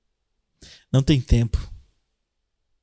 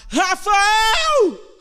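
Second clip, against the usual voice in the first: shouted call. Shown as a demonstration. Preacher contrasting calling with crying out, shouting "Rafael!". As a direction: cry out the name "Rafael!" as if across a field.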